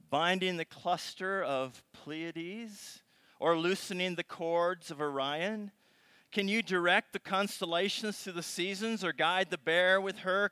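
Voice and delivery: loud, measured reading of God's speech; dramatic scripture reading. Acted. Preacher reading God's challenge to Job aloud. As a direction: proclaim each question slowly.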